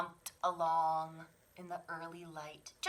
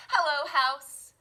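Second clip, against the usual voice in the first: happy greeting; 8 semitones higher, 15 dB louder than usual. Acted. Cub greets home.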